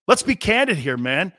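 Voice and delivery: ordinary talk, nothing marked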